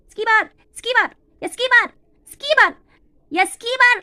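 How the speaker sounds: calling up. Acted. Barbie calls her sister at the upstairs window, loud and drawn out. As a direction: shout her sister's name up to the balcony.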